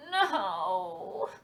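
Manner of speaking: moaning. A narrator's reading. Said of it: "moaned the fox".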